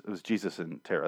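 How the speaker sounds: ordinary delivery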